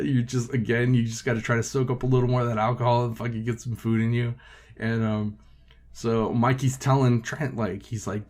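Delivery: ordinary talk, nothing marked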